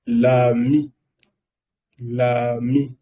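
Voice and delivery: L'ami said clearly twice for repeating; repeated demo. Shown as a demonstration. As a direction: model L'ami for the class. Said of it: "Voy a repetir una vez más". (L'ami repetido como modelo)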